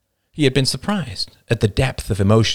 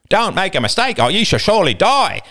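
mock Roman accent; 11 semitones higher, 3 dB louder than usual. Quoting Roman mockingly.

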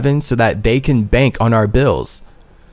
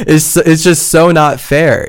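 higher, stumbling, upset complaint; upset protest; 5 semitones higher, 4 dB louder than usual. Real, not acted. Speaker facing eviction, upset and protesting helplessly. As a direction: protest helplessly just after an emotional outburst.

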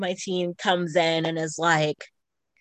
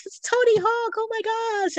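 high excited fan gush; fangirl squeal; faster than usual. Retold or imitated. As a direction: gush like a starstruck teen.

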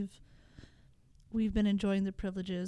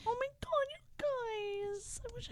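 high-pitched swoon; 14 semitones higher, 8 dB quieter, slower than usual. Real, not acted. Touched by sister talk.

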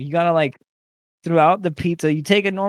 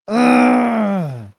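pained groan; 5 semitones higher, 6 dB louder than usual. Real, not acted. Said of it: "That hurt".

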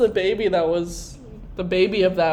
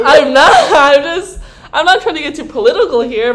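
laughing; 7 semitones higher, 8 dB louder than usual. Acted. Playful defence, laughing.

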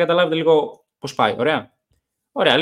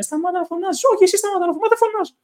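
mock yell, voice pitched way up; retold mock yelling; much faster than usual. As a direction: imitate a drunken squabble in a shrieky high pitch. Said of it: "Και φώναζε".

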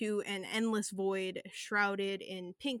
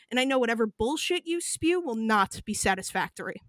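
angry scolding; 2 semitones higher, 7 dB louder than usual. Acted. Cobras scolds V in anger.

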